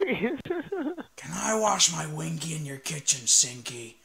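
slow silly put-on voice; slow goofy character voice. Impression line.

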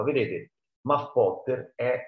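ordinary delivery, nothing marked

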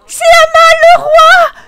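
high, loud excited shout. Real, not acted. Player cheering Amal crowned king, shouting excitedly. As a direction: cheer the result. Her pick wins prom king.

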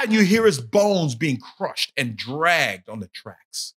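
ordinary talk

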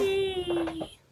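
shrill excited squeal; high squeal. Real, not acted. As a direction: squeal with delight. Other kid cheering over candy.

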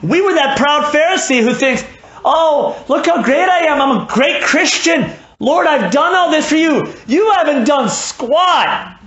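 high, loud, sneering boast; a proud Pharisee boasting to the Lord; mocking proud Pharisee. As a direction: parody a self-satisfied Pharisee.

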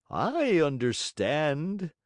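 very slow, dragged out villain; slowly realizing; assassin voice. Acted. One assassin to the other, very slow musing villain.

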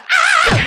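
sudden high loud scream. Pretend. Seeing the crocodile again, loud high scream.

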